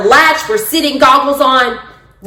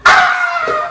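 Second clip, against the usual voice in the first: rising countdown into high yelp; countdown then mock scream; 11 semitones higher, much slower than usual. Instructors counting down the jump, ending in a yelp.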